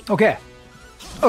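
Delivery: normal talking voice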